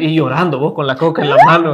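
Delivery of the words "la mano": laughing. Real, not acted. Mocking a crying child.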